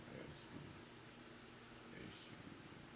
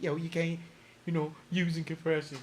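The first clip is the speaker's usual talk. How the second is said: high, loud imitation of a scoffing critic; mock doubter; 10 semitones higher, 7 dB louder than usual. Imitating a critic who says compression can't clean a beat, high and loud. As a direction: play the skeptic, up and loud.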